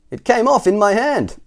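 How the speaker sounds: high, loud character voice; clumsy cousin's confession. A narrator's reading.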